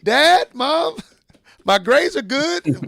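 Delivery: put-on high, loud child appealing to parents; little boy. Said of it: "Little Timmy".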